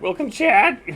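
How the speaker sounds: cracks way up, loud; exaggerated voice crack. Shown as a demonstration. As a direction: push the crack on purpose, loudly. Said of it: "Voice crack".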